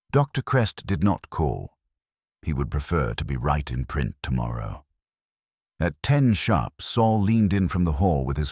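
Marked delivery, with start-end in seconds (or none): none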